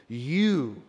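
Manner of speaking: YOU drawn out on a rise and fall; single out the listener with a weighty YOU; stressed, stretched YOU; emphatic YOU. Shown as a demonstration. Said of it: "is very emphatic".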